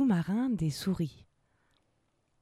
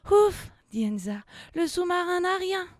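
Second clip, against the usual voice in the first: relieved; 11 semitones higher, 5 dB louder than usual. Acted. Relief after the collision.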